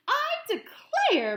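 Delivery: slow, very high, loud exclamation; Mama Bear exclaiming. A narrator's reading. Mama Bear after Goldilocks runs off, high exclamation. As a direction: exclaim it like a shocked mother bear. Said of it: "Mama Bear exclaimed".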